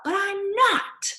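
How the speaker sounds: high, slow protest; insistent. Acted. Boy protesting to his doubting parents. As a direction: plead it, stretched out.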